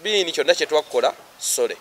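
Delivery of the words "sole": ordinary talk, nothing marked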